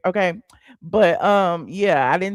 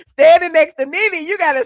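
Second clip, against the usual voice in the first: exaggerated exclaim; 7 semitones higher, 7 dB louder than usual. Real, not acted. Exaggerated complaint about Nene.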